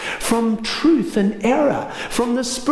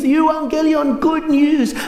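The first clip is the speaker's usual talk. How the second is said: high, loud triumphant cry; exhausted runner screaming the good news; messenger's scream; 8 semitones higher, 3 dB louder, slower than usual. Acted. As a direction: yell it across the village as you arrive. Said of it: "And he screams".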